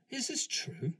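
high-pitched questioning officer; questioning colonel. Retold or imitated. Colonel asks the sergeant to confirm, questioning.

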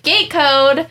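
high, loud re-enacted outburst; retold scream. Groomer freaking out over a gate code, retold scream. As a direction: exclaim it in mock panic. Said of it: "literally screaming in my car".

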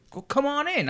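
high-pitched caricature of a welcoming star; Bill Cosby. Retold or imitated. Star waving young extra into his trailer, mock Cosby voice. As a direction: put on a comic, lofty invitation. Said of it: "He goes".

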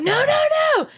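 high sharp warning cry. Retold or imitated. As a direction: stop the baby sharply. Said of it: "I'm like".

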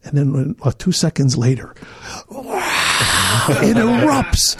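usual speaking voice

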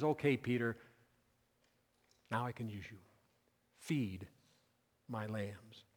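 soft, low reassurance with long pauses; gentle acceptance. Acted. Preacher voices Christ accepting a humbled Peter, gentle.

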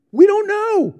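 high, loud, helpless cry; panicked answer. Retold or imitated. Two young soldiers answering the crew, high panicked cry. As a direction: blurt it out in terror.

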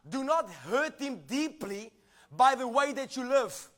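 usual speaking voice

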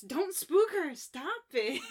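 playful high-pitched protest; all-caps outburst. Retold or imitated. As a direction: exclaim it like an all-caps message. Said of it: "just wrote in all caps".

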